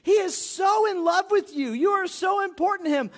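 loud, high impassioned preaching; fervent preaching. Acted. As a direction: preach it out with fire. Preaching at full force.

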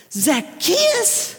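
Zacchaeus? squeaked way up high in disbelief; scandalized cry of Zacchaeus?, pitched high; Zacchaeus? as an outraged churchgoer; incredulous. Retold or imitated. Crowd outraged at Jesus' choice.

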